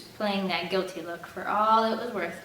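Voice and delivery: usual speaking voice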